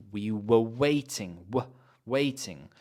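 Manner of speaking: "were" reduced to wuh before stressed "waiting"; weak "were". Shown as a demonstration. Teacher repeats the model, weak "were". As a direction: pass quickly over "were", land on WAITING. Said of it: "using weak forms to unstress words".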